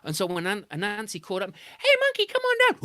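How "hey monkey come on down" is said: very high loud sing-song call. A narrator's reading. Anansi calling Monkey down from the tree, high sing-song. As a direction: call him down sweetly.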